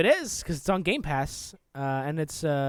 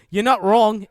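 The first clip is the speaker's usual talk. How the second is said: put-on terrible Canadian accent; 3 semitones higher, 9 dB louder than usual. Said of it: "terrible Canadian accent".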